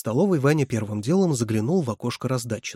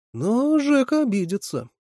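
higher, louder, slow and wry; wry grimace; 13 semitones higher, 6 dB louder than usual. A narrator's reading. Boy grimaces about his offended friend, higher and wry. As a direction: pull a sour face through the words. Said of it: "скривился Лёня".

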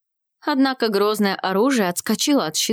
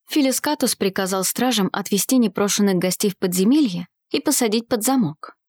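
ordinary delivery, nothing marked

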